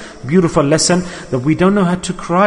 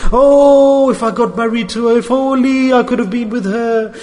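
mock lovesick crying; 5 semitones higher than usual. Preacher imitating a man crying for a woman, mock lovesick wail. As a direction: wail with regret like a heartbroken man. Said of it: "when a man cries for a woman, he cries in a different way".

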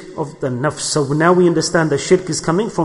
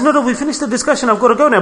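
high, fast flustered excuse; hurried guest; 8 semitones higher, faster than usual. Retold or imitated. Guest hurrying to leave the host, high and fast. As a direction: rush the exit line, pitched up. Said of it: "He says".

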